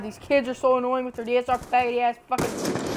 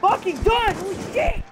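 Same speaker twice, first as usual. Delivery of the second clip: high, loud rage shout; furious outburst; 10 semitones higher, 9 dB louder than usual. Real, not acted. Gamer raging after a death, furious high shout. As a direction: explode in real frustration.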